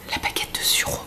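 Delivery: whispered product name; playful whispered aside. Acted. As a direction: breathe it out, no voice.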